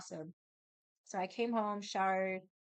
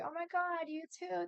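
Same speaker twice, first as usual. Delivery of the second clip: gushing thanks; 10 semitones higher than usual. Retold or imitated. Gushing at sweet cashier.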